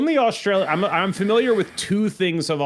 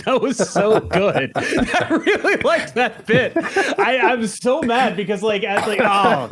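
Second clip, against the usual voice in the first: real delighted laughter breaking into words; laughing; 8 semitones higher, 4 dB louder than usual. Real, not acted. Co-host cracking up at a stolen comedy bit, laughing. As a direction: laugh through it, higher and louder.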